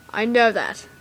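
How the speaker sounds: ordinary delivery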